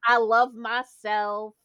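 mimicked teacher, slow and deliberate; imitated acting teacher. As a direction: draw it out like a teacher leading a mantra. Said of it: "and he goes".

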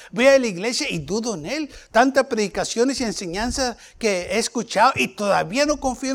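high, insistent pulpit exhortation; fervent preaching. Acted. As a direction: declare it with rising conviction. Exhorts congregation to declare trust.

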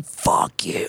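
strained mock yell, held back in volume; retold yell. Man yells at a nice guy in exercise, mock yell. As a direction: imitate screaming at someone without full force. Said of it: "I'm yelling".

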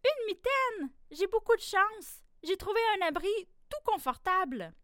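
a tiny mouse thrilled to find shelter; delighted little mouse. A narrator's reading. Cold mouse finding the mitten, high excited squeak. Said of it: "Elle se dit".

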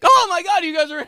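very high, loud squeal of surprise; high mock shock. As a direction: overplay the shocked intruder. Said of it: "being like".

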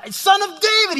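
high, loud desperate shout; all-out acted plea. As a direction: shout over the crowd to be noticed. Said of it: "he cried out even louder".

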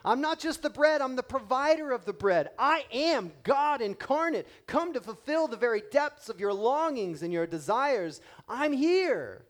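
loud, high proclaiming of Jesus's words; full-force preaching. Acted. Jesus declaring to the crowd, loud proclaiming preacher voice. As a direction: proclaim it as God himself, loud and soaring.

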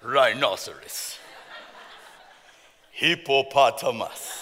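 hammed-up low dopey Adam; silly Adam voice. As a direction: drag out each animal name low and slow like a dim caveman. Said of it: "I don't know why Adam talks like that".

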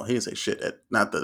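normal talking voice

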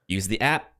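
normal talking voice

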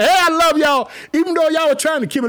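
high, loud, beaming shout of love; joyful David. Acted. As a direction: call it out full of joy. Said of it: "David just smiling".

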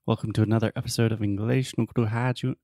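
ordinary talk, nothing marked

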